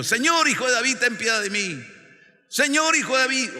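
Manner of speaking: high, loud call for mercy; acted pleading shout; crying out. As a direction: call out as the blind men would. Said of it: "En unos cuantos gritos".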